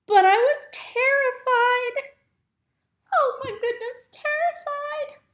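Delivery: high, loud, panicky cry of terror; terrified. A narrator's reading. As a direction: shriek out the fear of the climb. Story climax, narrator as terrified egg.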